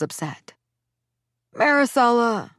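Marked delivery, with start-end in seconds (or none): 1.5-2.5 louder, higher pleading call of her name. A narrator's reading. Father pleading with the mother over the phone. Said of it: "my father pleaded".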